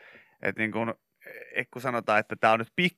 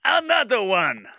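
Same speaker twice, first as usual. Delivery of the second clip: high, slow hype call; 8 semitones higher, 4 dB louder, slower than usual. Retold or imitated. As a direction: drag the line out like a DJ tag. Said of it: "hän huutaa myös".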